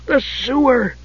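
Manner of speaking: high-pitched kender wonder; excited Tass. Acted. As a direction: exclaim high, thrilled by the find. Tass thrilled by the sewer.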